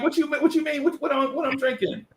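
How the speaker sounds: put-on high, flustered teen girl; girl's high voice. Lisa caught off guard by the boy, high pitched. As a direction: sound thrown by the question. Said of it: "she's like".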